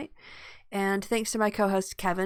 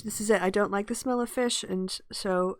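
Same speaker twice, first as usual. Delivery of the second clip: normal talking voice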